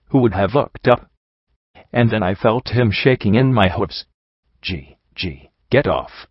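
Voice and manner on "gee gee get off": shaken low, halting stammer. A narrator's reading. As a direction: stammer it out softly and lower, broken by pauses. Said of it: "he stammered".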